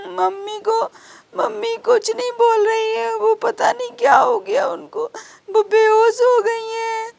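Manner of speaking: put-on high child's voice, sobbing through the words; crying child. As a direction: sob it out like a scared little girl. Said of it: "Ragini रोते हुई शीला के पास जाती है". (रोती हुई छोटी बच्ची की आवाज़)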